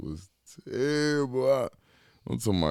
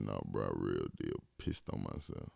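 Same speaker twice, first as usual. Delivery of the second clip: sheepish insistence; 10 semitones lower, 12 dB quieter than usual. Retold or imitated. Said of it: "He said".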